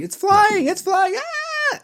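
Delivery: high panicked shrieking; hammed-up scream over a bug; mock child scream. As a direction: screech it like a terrified kid. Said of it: "She's screaming".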